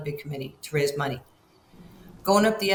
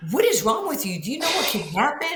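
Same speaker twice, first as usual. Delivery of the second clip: put-on a frightened adult shouting at a kid; alarmed shout; 8 semitones higher, faster than usual. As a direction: yell it out in alarm. Shouted quote in retold story.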